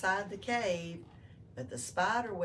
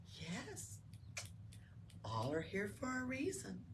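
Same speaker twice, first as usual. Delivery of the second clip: higher, very soft and drowsy; gentle hush; 4 semitones higher, 10 dB quieter than usual. Acted. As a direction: murmur it softly as sleep comes.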